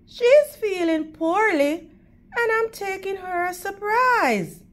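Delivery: put-on high, startled girlish voice; prim little girl. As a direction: gasp it out like a proper little girl.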